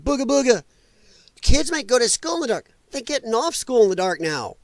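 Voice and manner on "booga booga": higher, mocking spooky-ghost voice; mocking imitation. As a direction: sneer it like a silly scare tactic. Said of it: "People like".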